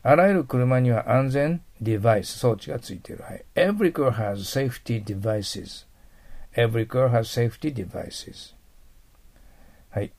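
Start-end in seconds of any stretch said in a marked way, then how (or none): 3.5-8.5 slow, clearly spaced English sentence; slow model reading. Shown as a demonstration. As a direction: read it slowly for students to repeat. (ゆっくりはっきり読む例文)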